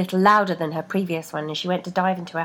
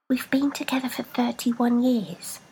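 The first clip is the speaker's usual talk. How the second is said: lightly voiced, slightly higher; wry reminder; a hint of foot voice; 5 semitones higher than usual. A narrator's reading. Foot reminding the woman, lightly voiced.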